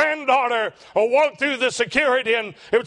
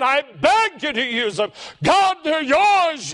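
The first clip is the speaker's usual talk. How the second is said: impassioned plea, swelling higher and drawn out; pleading prayer; 5 semitones higher, slower than usual. Retold or imitated. Father praying over his sleeping children, pleading. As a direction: beg earnestly, stretching the words. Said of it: "beg God to use us".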